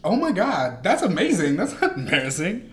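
high, loud gleeful surprise; delighted amazement. Real, not acted. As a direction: burst out with delight. Reacts to Halloween confession.

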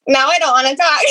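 laughing self-conscious protest; real laugh while pretending to clam up. Real, not acted. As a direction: joke that you're shy now, through a real laugh. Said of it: "No, I love it".

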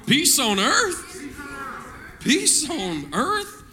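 "Peace on earth?" very slow, with a big swoop on earth; like a question. Shown as a demonstration. Preacher urges church to join, drawn-out questioning "Peace on earth?". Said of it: "Say that like a question".

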